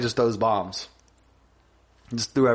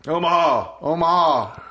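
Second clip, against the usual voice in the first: higher-pitched punchy snap call; mock quarterback call; 4 semitones higher, 4 dB louder, slower than usual. Dubbed-over quarterback at the line, imitated shout. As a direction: call it out twice, evenly.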